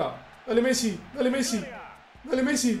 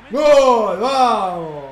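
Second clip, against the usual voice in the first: loud drawn-out cheer; goal celebration shout; 8 dB louder, much slower than usual. Real, not acted. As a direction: belt out the goal, then let it fall. Sigue celebrando el gol. (grito de gol largo y fuerte)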